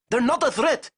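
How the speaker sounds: high, loud, urgent pleading; yelled protest. Acted. Azov yelling at Zayson to spare the group. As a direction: yell that they are harmless. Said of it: "Azov yelled".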